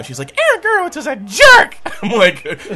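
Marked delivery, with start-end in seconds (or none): 0.4-1.8 loud, high imitated outrage; mock-furious high outburst; indignant girl. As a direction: spit the insult in a high girl's voice. Girl angry about Aaron.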